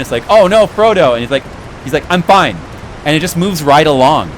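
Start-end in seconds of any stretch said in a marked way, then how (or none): 0.3-1.1 brief high, louder cry of concern; quick mock alarm. Fan mimicking the cartoon's quick stabbing moment, mock alarm. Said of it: "it's like a real quick moment". 2.1-2.6 brisk, higher and louder brush-off; Frodo, quick reassurance. Retold or imitated. Fan imitating cartoon Frodo after being stabbed, brisk brush-off. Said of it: "it's like a real quick moment".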